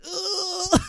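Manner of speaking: high strained croak; hoarse groan. Retold or imitated. Mock hoarse groan from talking like Keanu all day. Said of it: "how hoarse your voice is".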